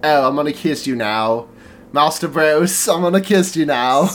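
a hint of Michael Caine crossed with a creepy cartoon; creepy British accent, lightly done; creepy British impression. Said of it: "combination of Michael Caine and Salad Fingers".

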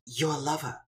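Faust's voice. Acted. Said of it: "speaking in their voice".

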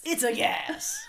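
very high, loud squeal of the catchphrase; squeaky catchphrase. Pretend. Echoing the guest's catchphrase in a squeaky cartoon voice. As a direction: shriek it out in a cartoon voice.

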